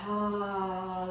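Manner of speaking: long audible exhale on ah; open mouth sigh. Shown as a demonstration. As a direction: sigh it all out through the mouth. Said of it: "And open mouth sigh".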